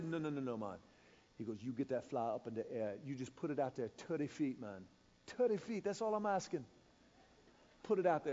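quietly firm coaching; lightly hushed guide. Retold or imitated. Guide instructing quietly near fish.

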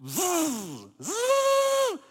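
high loud buzzing zzz; reel whine. Retold or imitated. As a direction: imitate the line screaming off the reel. Imitated reel screaming.

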